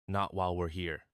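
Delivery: ordinary delivery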